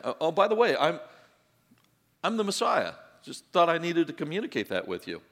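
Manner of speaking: imitating a clumsy confession; mock awkward announcement. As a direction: drop it in casually, tongue in cheek. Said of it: "how do you throw that in there".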